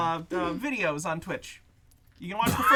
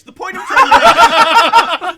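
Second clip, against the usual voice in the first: laughing; 10 semitones higher, 19 dB louder, much slower than usual. Real, not acted. Laughing over others.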